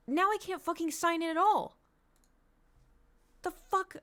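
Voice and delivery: high-pitched, incredulous frustration; angry disbelief. Real, not acted. Streamer unable to sign in at all, angry disbelief. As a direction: let the pitch climb in outrage. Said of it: "I'm so fucking angry".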